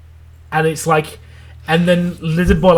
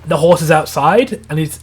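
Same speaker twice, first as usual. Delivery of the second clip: ordinary delivery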